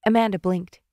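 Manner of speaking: ordinary delivery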